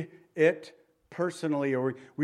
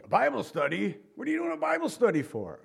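much higher, fast disbelieving guard; incredulous guard; 6 semitones higher than usual. Retold or imitated. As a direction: toss the question back in disbelief. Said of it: "the border guard".

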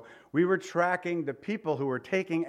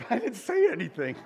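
innocent protest; 7 semitones higher than usual. Retold or imitated. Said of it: "And I'm like".

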